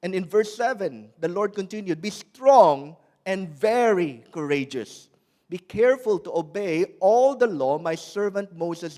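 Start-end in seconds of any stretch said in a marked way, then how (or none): 2.0-9.0 proclaimed command. Acted. God's command voiced in sermon.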